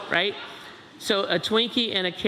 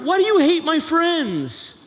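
high, loud hurt whine; teen accusing parent over friends; 11 semitones higher, 5 dB louder than usual. Acted. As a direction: wail it like a wounded teenager.

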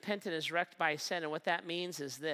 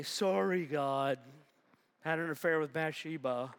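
flippant apology. Pretend. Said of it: "First of all, I don't mean it".

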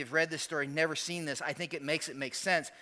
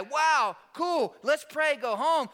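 mock enthusiasm; 7 semitones higher, 5 dB louder, slower than usual. Mocking a shallow reaction.

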